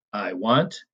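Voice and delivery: light "I", weighted "want"; unstressed then stressed. Shown as a demonstration. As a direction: lean on "want" after a light "I". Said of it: "unstressed followed by stressed".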